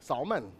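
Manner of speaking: "Salmon" as SAHL-mun, L heard; echoed L pronunciation. Retold or imitated. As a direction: repeat "Salmon" with a sounded L. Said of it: "You say salmon?".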